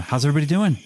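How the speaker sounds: first attempt at a character voice, a little high; lightly voiced, off-target character greeting; botched character voice. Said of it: "I totally messed up the voice".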